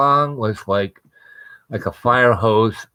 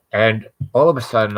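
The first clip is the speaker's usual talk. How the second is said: ordinary delivery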